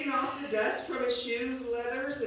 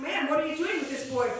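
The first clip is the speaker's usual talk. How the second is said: confronting; 5 semitones higher, 5 dB louder than usual. Acted. Martin confronts woman holding boy.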